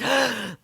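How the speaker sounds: high, sharp gasp; sudden high intake of breath; startled gasp. Real, not acted. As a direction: gasp in real alarm. Gasp after near mishap.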